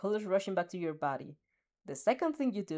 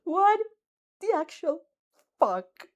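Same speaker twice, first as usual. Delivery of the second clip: loud, much higher stunned outburst; shocked; 10 semitones higher, 9 dB louder, slower than usual. Acted. Reacting to the announcer's love comment, loud shocked outburst. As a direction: blurt it out in total disbelief.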